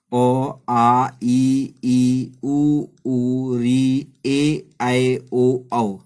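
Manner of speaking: each vowel called out loud and separate; loud vowel drill. Shown as a demonstration. Teacher reciting vowels for the class, loud and distinct. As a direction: spell out ओ, आ, ई, उ, ऊ, री, ए, ऐ, ओ, औ one by one, loud and distinct. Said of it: "कैसे?".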